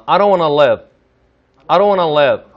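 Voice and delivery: "live" short and lax, like "lev"; short i demo. Shown as a demonstration. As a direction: model the sentence clearly, ending on a relaxed "live". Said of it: "이렇게 한번 발음해 보겠습니다".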